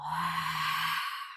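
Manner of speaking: long, breathy sighing exhale; audible exhale. Shown as a demonstration. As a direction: let the breath out audibly through the mouth. Exhale through your mouth, audible.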